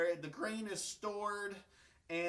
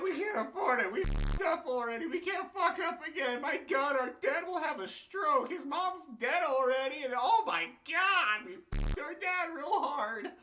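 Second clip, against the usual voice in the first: higher, louder frantic babbling; mock panic; 8 semitones higher, 8 dB louder than usual. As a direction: blurt it out like panicking brothers. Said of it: "he's like".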